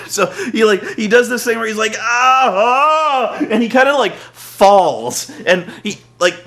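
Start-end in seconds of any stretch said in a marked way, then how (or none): 2.0-3.4 high, loud mock cries of pain. Wade Barrett hurting his back, high loud pained cries. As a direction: yelp like your back just went. Imitates Wade's back giving out.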